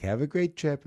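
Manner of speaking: put-on Boston accent. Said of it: "get back into the accent".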